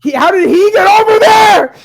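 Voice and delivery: high, loud, hyped-up play-by-play; excited shouting. Real, not acted. Narrator hyping the spider fight to friends, excited shouting. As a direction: yell the action like it's a big match.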